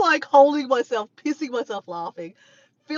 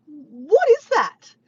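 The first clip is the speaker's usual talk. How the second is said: slow, loud, high mock disbelief; imitating a baffled question; retold bewilderment; 6 semitones higher, 7 dB louder, much slower than usual. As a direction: draw it out like a baffled onlooker. Retold puzzled reaction to the fish.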